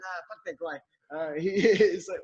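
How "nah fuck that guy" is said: much softer, tossed off; brushing the guy aside; dismissive. Retold or imitated. George dismissing DiCaprio.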